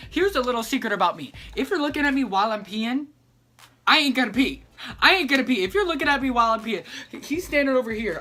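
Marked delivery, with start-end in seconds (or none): 3.9-5.6 loud, insistent repeat; emphatic. Real, not acted. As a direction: hammer it home. Emphatic confession to viewers.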